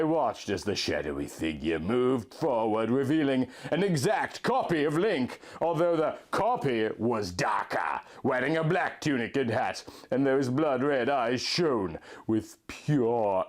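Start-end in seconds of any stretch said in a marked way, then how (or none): none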